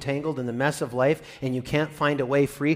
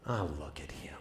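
breathy disappointed whisper, far softer; weary whisper; 14 semitones lower, 17 dB quieter than usual. Pretend. A disappointed God whispering over us. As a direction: sigh it out, let down. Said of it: "the words he's saying are".

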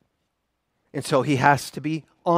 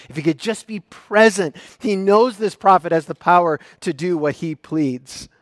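raised, intense retelling of the father's plea; dramatic storytelling; 5 semitones higher, 7 dB louder than usual. Acted. Preacher painting the father's plea for the congregation, intense. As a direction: paint the desperate scene with rising urgency.

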